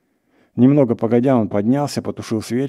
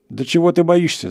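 high-pitched, raised challenge; acted shout, moderately loud; exasperated shout; 9 semitones higher, 4 dB louder than usual. A narrator's reading. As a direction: cry out at a coward. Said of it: "закричал охотник".

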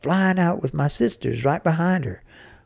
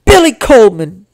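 high, very loud scolding call; angry shout; 7 semitones higher, 11 dB louder than usual. A narrator's reading. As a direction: shout the full name as a warning. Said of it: "she shouted".

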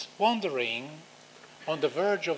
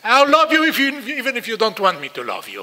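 put-on higher, louder, yelling love at the child; screamed declaration; 7 semitones higher, 11 dB louder than usual. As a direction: scream it so the child can't look away. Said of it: "you can scream at the child".

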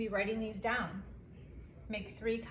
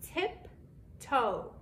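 "Tiptoe" in two beats with a long gap; split into syllables; 2 semitones higher than usual. Shown as a demonstration. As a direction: say "Tiptoe" as tip, pause, toe. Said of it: "count how many times it bounces".

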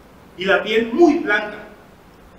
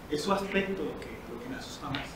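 hushed fear; 8 semitones lower, 11 dB quieter than usual. Acted. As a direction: voice sinking to near nothing. Confessing fear, voice drops.

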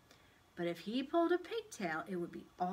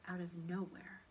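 usual speaking voice

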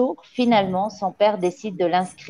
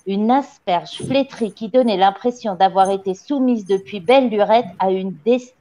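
ordinary delivery, nothing marked

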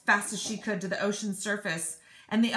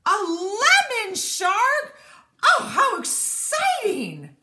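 loud, high and slow delighted cry; excited shout; 14 semitones higher, 10 dB louder, much slower than usual. Acted. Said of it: "she shouted".

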